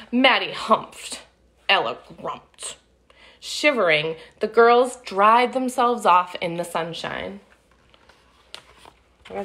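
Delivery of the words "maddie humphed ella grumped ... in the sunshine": put-on lively read-aloud for kids; storybook narration. A narrator's reading. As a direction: tell it like a picture book to children. Reading picture book aloud.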